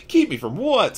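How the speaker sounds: high, loud eager question. A narrator's reading. Harry eager to know what was kept from him. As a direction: burst out eagerly. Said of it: "said Harry eagerly".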